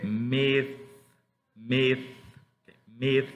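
myth with the tongue between the teeth; slow teaching demo of myth ending in th; careful th. Shown as a demonstration. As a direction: stretch the th at the end of myth. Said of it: "Recuerden la pronunciación de la T H".

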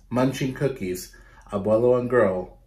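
ordinary delivery

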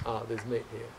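a light mock of a prissy guest, rushing the second half; mocking fussy vegetarian. Mocking the fussy vegetarian type urging her darling to leave. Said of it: "that kind of vegetarian who says".

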